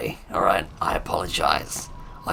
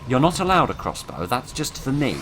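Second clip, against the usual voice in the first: high, loud mock-outraged protest; indignant outcry; 8 semitones higher, 6 dB louder than usual. Real, not acted. Gamer protesting that the enemy has a crossbow.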